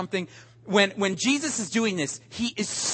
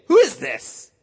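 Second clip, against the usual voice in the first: higher, louder annoyed "Who is this?"; indignant; 7 semitones higher, 7 dB louder than usual. Retold or imitated. Preacher on the plane, irritated at the seat-taker. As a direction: bristle at the stranger. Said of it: "Like, negative".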